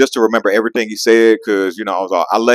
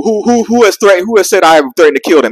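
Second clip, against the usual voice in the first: mock heated demand; 8 semitones higher than usual. Demanding who accused him.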